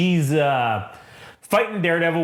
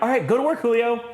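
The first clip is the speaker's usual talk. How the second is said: higher, breezy put-on voice; mock boss; 9 semitones higher than usual. Wesley sending Julio back to work, high and breezy. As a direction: toss it off high and chipper. Boss dismissing janitor, retold.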